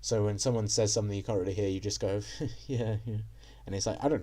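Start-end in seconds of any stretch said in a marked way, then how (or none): 2.4-3.2 polite fake chuckle through "yeah, yeah"; awkward chuckle. Shown as a demonstration. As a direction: chuckle awkwardly, feigning that you heard. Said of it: "You do this awkward chuckle".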